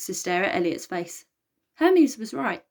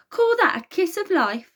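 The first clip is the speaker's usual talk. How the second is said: high, loud wild shriek; wild scream; 11 semitones higher, 5 dB louder than usual. A narrator's reading. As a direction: scream it at them in outrage. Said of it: "she screamed wildly".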